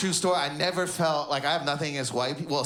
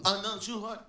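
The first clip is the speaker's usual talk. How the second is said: higher mock mimicry; mocking imitation; 4 semitones higher, 4 dB quieter than usual. Man mimicking the comic's Arabic, mocking. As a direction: mimic his clumsy speech to tease. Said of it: "he was mocking me".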